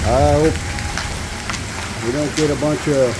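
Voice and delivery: talking loudly over pounding hail; in a hailstorm. Real, not acted. Loud hailstorm outside.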